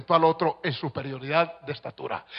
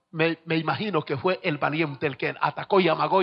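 ordinary delivery

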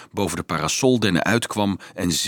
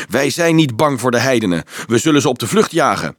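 louder, higher, fast and defiant; rally the others, full of fight; eager battle cry from a big boy; combative shout; 4 semitones higher, 6 dB louder, faster than usual. A narrator's reading. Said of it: "riep een grote jongen strijdlustig".

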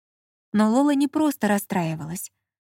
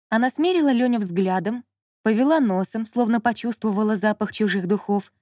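ordinary delivery, nothing marked

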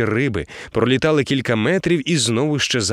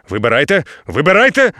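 loud urgent call; 5 dB louder than usual. A narrator's reading. Captain urging hero to reel in, loud call. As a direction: shout it across the deck. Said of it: "гукнув капітан".

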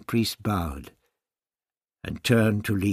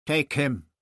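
a higher, louder order; a curt order to the guards; commanding; 6 semitones higher, 6 dB louder than usual. A narrator's reading. High priest ordering guards to seize the doctor, commanding. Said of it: "Totoxel commanded".

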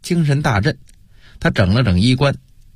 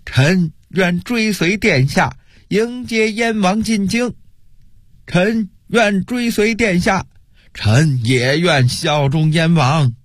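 slow, drawn-out, higher-pitched declaration; called-out pledge; 7 semitones higher, slower than usual. A narrator's reading. Frightened officials declaring allegiance to the prince, called-out pledge. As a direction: proclaim allegiance in long, stretched syllables. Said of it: "不知道谁喊了一声".

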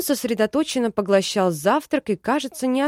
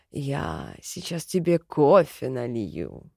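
low, slow caring male voice; low man's voice; 8 semitones lower, slower than usual. A narrator's reading. Another man offering coffee. (низкий медленный мужской голос)

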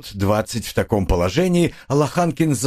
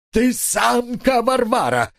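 very high, slow keening wail; drawn-out whining; 13 semitones higher, slower than usual. A narrator's reading. Husband insults his wife, whining long and high. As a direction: stretch every vowel into a whine. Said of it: "тягуче заныл он".

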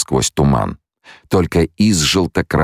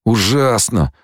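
higher-pitched moan of horror; 9 semitones higher, slower than usual. A narrator's reading. Wounded man being led past, moaning. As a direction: moan it in pain, pitch raised. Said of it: "стонал он".

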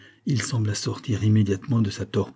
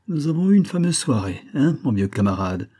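high-pitched, lightly acted drunk; tipsy loud talk; 6 semitones higher, 4 dB louder than usual. A narrator's reading. Drunk young baronet hailing his old comrade, tipsy and high-pitched. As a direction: play a merry drunkard, mildly. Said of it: "parlait très haut, mais sa voix était épaisse et indistincte".

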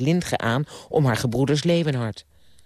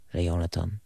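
ordinary delivery

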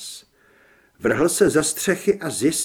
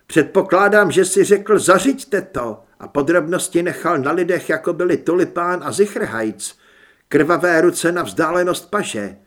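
raised, louder voice of an accusing son; heated accusation; 3 semitones higher, 7 dB louder than usual. A narrator's reading. Son angrily accuses his father. (rozhořčené obvinění)

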